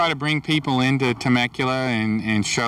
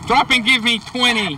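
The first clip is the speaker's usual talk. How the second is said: barked high and loud drill order; drill sergeant; 10 semitones higher, 4 dB louder than usual. Acted. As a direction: bark it like a boot camp sergeant. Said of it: "even a drill sergeant".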